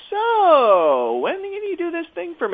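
long, high, loud "so", then the request; imitated eager ask. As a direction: mimic someone pivoting abruptly to a favor. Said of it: "And then he'd be like".

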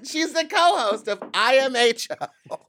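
loud, high, drawn-out announcing; announcer introduction. Pretend. Host bringing out the guest, big announcer voice. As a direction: proclaim the guest's credit slowly.

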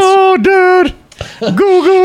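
high, loud squeaky cry; panicked little clay man voice; Mister Bill voice. Retold or imitated. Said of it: "She sounds like Mister Bill".

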